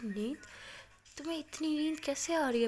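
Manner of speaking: astonished question, pitch jumping up; surprised. Retold or imitated. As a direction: ask it in disbelief. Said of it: "Alia एकदम हैरान कहती".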